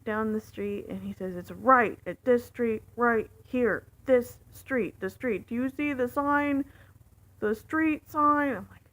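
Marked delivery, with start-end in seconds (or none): 1.3-8.5 loud, slow, emphatic directions; imitated exasperated shouting; yelling. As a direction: spell it out loudly for a driver you think is stupid. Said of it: "he's yelling at me at this point".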